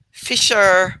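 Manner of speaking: "fisherman" stressed, with a pause before it; emphasis on fisherman. Shown as a demonstration. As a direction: lean hard on "fisherman". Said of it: "I'm emphasizing".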